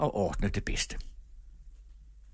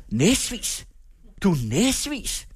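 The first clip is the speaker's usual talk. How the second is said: high, slow, outraged accusation; indignant scolding; 7 semitones higher, much slower than usual. A narrator's reading. Teacher rebukes the girl for answering back, high and slow. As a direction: spit out each word at the cheeky girl.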